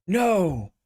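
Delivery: sudden loud high cry; desperate shout. Acted. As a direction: yell it as you fall. Spider-Man sucked into void.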